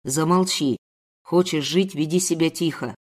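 low, threatening male voice; menacing captor. Acted. Captor threatening the woman, low menace. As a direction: warn her darkly, pitched low. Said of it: "злобного взгляда чёрных глаз".

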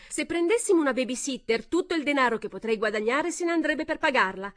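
high, loud start. A narrator's reading. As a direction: raise your voice over them. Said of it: "parlava a voce ancora più alta".

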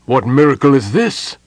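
loud, lifted cry of wonder; awed cry. A narrator's reading. Parlin sees Gold Moon made young, crying out in awe. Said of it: "Parlin cried".

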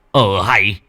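furious scolding. A narrator's reading. Grandfather stamps and scolds.

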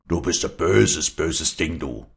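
mildly angry scolding, voiced aloud; muttering through teeth. A narrator's reading. Father scolds his daughter, muttering through his teeth. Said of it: "murmelte er zwischen den Zähnen".

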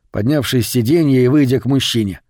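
ordinary delivery, nothing marked

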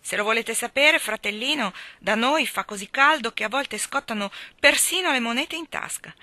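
big-voiced boast about the heat; thundering. A narrator's reading. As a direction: thunder the tall tale at the little guest. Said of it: "tuonò Myrtleton".

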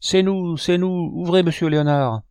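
put-on high children's voices calling out; a crowd of kids shouting through the door, pitched high; excited children yelling to be let in. A narrator's reading. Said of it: "voix claires se mirent à crier".

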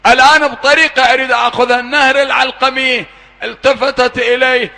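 high, loud, wailing sermon narration; impassioned mourning sermon; preaching at full force. Acted. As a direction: wail the story to bring the hall to tears. Preacher retells the burning tents.

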